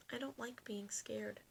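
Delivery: soft whisper; whispered admission. Acted. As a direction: confess it quietly. Dragon admits fear.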